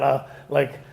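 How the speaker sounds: ordinary talk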